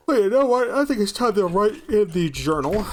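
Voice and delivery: loud, high burst of wrap-up energy; excited announcement. Real, not acted. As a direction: announce the wrap-up like a show host.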